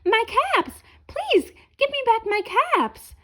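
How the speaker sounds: high pleading cries for his caps; calling out. A narrator's reading. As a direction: call after the parade, pleading.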